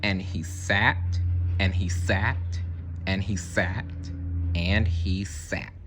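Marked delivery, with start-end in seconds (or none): none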